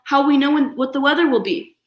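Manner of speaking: loud, high fretful question; protesting Papa. Acted. Papa frets to Mama about the weather forecast, high and loud. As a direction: worry aloud like a helpless dad.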